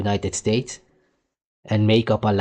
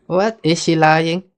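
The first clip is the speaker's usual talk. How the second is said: sudden loud, higher outraged question; outraged disbelief; 8 semitones higher, 7 dB louder, slower than usual. Real, not acted. Reacting to the Grammy speech, a loud, higher, outraged what. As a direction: fire back in shocked disbelief.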